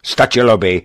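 heated insistence; insistent protest. A narrator's reading. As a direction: keep pressing the point. Lockhart keeps arguing.

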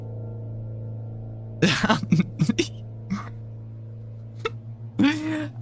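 airy giggle after a fright; breathless relieved laugh. Real, not acted. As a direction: laugh off the scare.

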